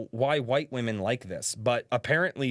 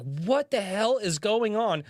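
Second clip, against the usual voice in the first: surprised; 9 semitones higher, slower than usual. Retold or imitated. Retelling walking into spicy steam.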